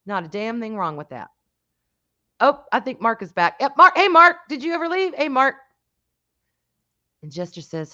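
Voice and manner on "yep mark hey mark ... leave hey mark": loud, higher hail to someone returning; raised-voice hello; calling out. Real, not acted. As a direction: call his name across the room. Calls out to returning viewer.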